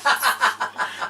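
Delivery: high unvoiced laugh bursting out; burst of laughter. Real, not acted. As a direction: let a loud breathy laugh escape.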